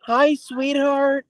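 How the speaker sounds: very high, slow saccharine greeting; fake sweet greeting. Mocking fake-nice people with a very high slow coo. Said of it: "You know what they do? They're like".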